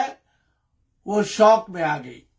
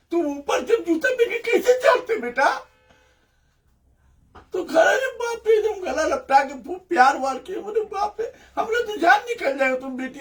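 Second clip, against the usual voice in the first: emotional father; 6 semitones higher than usual. Retold or imitated. Father voiced affectionately, emotional.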